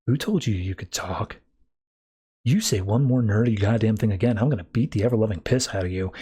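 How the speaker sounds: low, gruff menace, voice dropped deep; mock bully voice. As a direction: growl the threat down at the nerd like a thug.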